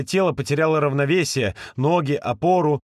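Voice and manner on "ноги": ordinary delivery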